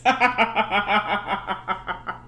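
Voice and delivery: long rapid cackling laugh. Real, not acted. Cracking up over Ricky's little mishap, laughing. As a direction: crack up at your own joke.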